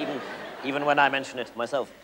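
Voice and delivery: usual speaking voice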